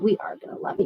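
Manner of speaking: whispered. Real, not acted. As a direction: whisper it to yourself, unsure. Trying to convince herself.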